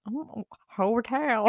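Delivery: hotel said with a touch of country twang; slight twang. Real, not acted. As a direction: let a little twang slip into hotel. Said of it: "She's got herself a little twang tonight".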